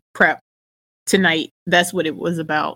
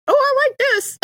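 delighted squeal. Retold or imitated. Quoting herself on the ball.

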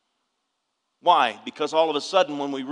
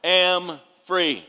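loud rallying shout, higher pitch; congregation call and response; 4 semitones higher, 8 dB louder, much slower than usual. Shown as a demonstration. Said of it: "Can you say that with me?".

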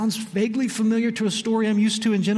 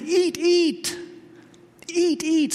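higher, eager repeated "eat"; emphatic invitation; 8 semitones higher, much slower than usual. Retold or imitated. Preacher voicing God's eager invitation to eat, emphatic. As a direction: urge them on, generous and insistent. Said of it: "The Hebrew is emphatic".

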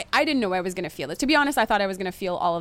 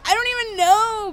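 high, loud mock wail; hammed-up helpless cry; imitating crying; 12 semitones higher, 6 dB louder than usual. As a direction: retell it as a tearful blurt. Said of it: "crying and she's like".

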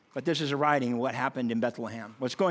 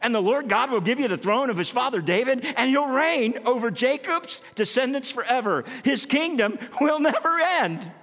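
joyful announcement; 8 semitones higher, 4 dB louder than usual. Pretend.

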